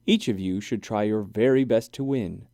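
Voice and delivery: plain reading voice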